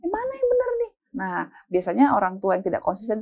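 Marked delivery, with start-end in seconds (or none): 0.0-0.9 high, bewildered complaint; exasperated child. Acted. Child puzzled by inconsistent parents, high exasperated whine. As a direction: protest like a confused kid.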